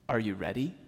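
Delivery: a driving instructor quietly checking his nervous student; calm instructor. Retold or imitated. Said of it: "the driving instructor right beside her says".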